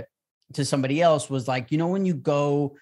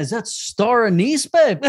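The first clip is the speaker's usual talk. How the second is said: higher, louder, slow teasing question; mock asking; 7 semitones higher, 6 dB louder, slower than usual. Mocking someone who can't name spices, teasing question. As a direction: ham up a clueless guess to your partner.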